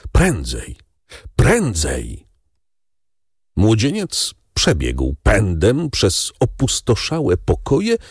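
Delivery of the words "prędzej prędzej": low, drawn-in urging; understated urging, pitched low as if unspoken; urgent silent plea. A narrator's reading. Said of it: "zdawały się wołać". (stonowane, niskie ponaglenie)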